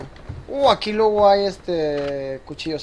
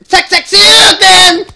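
slow, louder, higher stretched shout; drawn-out battle cry; 6 semitones higher, 6 dB louder, much slower than usual. Pretend. Gamer hamming up a special move, slow shout.

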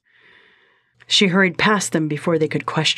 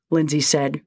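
ordinary delivery, nothing marked

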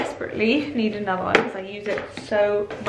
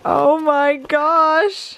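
slow, rising oh my gosh in wonder; drawn-out awe; 6 semitones higher, 4 dB louder, much slower than usual. Real, not acted. Vlogger seeing her name on the bag, awed. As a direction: savour the surprise.

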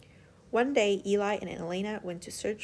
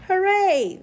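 high, loud joyful cheer; cheering shout; 10 semitones higher, 10 dB louder than usual. Acted. The park animals cheering for Eli and the crew. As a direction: cheer for the rescue at the top of your voice. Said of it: "they shouted".

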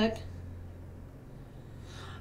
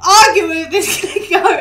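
laughing; 6 semitones higher, 11 dB louder than usual. Real, not acted. Laughing at her own goat argument.

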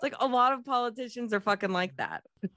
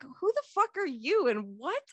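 high, disbelieving rant; mock outrage, pitched up; incredulous; 8 semitones higher than usual. Reacting to the movie scene, high incredulous outburst. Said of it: "And I'm like".

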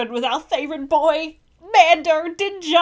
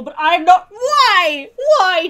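loud, higher-pitched wail of disbelief; real anguished protest; 3 semitones higher, 6 dB louder, much slower than usual. Real, not acted. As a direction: plead loudly against what you see. Protesting what appears on screen.

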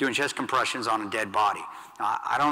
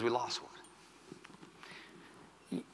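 barely voiced, slow and drawn out; hushed whisper; 15 dB quieter, much slower than usual. Teammate breaks the news in a stunned whisper. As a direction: breathe the words out, almost no voice.

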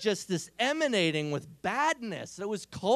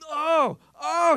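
mock strained cry; 9 semitones higher, 9 dB louder than usual. Niece groaning under weight.

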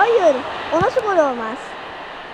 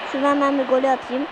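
normal talking voice

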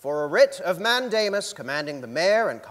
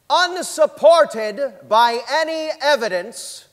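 slow, raised and louder, every word pressed; indignant emphasis; 7 semitones higher, 4 dB louder, slower than usual. Acted. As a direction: hammer home the lack of evidence. Court opinion read with indignation.

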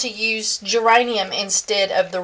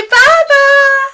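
put-on high, louder little-kid voice; voicing the baby; 14 semitones higher, 7 dB louder than usual. Grandma speaking for baby Henry to viewers, high baby voice.

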